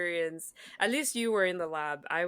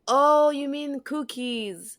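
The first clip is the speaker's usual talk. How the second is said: high, slow "oh" of dawning understanding; puzzled realization; 9 semitones higher, 5 dB louder, slower than usual. Retold or imitated. Student realizes the guide means cookies, slowly dawning. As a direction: drag it out as it clicks. Students correcting the foreign word.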